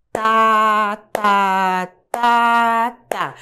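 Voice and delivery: "tá" held long on each beat, level pitch; steady, evenly spaced "tá", one to a beat; one syllable per pulse. Shown as a demonstration. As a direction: chant a long, even "tá" on every pulse. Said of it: "escolhi tá".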